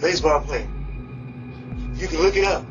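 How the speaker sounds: desperate pleading through an intercom; doorbell speaker. Real, not acted. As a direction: beg to be let in. Begging at intercom, doorbell audio.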